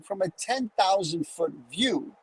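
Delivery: usual speaking voice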